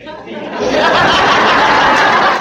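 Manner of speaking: airy high unvoiced laughter; real laugh. Real, not acted. Preacher joking about the political debate, laughing. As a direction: laugh at your own joke.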